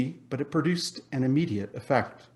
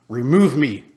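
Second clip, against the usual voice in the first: loud, desperate cry; exclaimed; 10 dB louder than usual. A narrator's reading. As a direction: cry out to be freed. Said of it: "Scrooge exclaimed".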